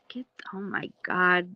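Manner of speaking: normal talking voice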